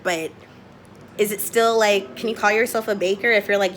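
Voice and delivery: ordinary delivery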